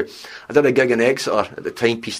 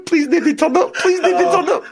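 pleading high while laughing; laughing plea. Retold or imitated. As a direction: beg the crowd to stay away, giggling. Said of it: "We were at the door going".

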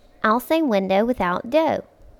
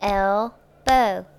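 "El-bow" as two separated parts; broken into syllables. Shown as a demonstration. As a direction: split "El-bow" with a clear pause, one beat per part. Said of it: "clap for each part".

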